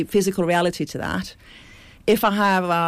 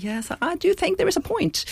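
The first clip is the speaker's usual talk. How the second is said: much higher, light quoted voice; retorting reply; 11 semitones higher than usual. Retold or imitated. Defending her idea to the posh panelist, raised pitch. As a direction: answer him back brightly in a raised voice. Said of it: "I was like".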